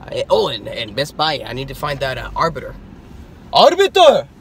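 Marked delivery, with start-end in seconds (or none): 3.5-4.3 high, loud exclamation; excited shout. Real, not acted. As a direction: blurt it out thrilled. Spotting a toy excitedly.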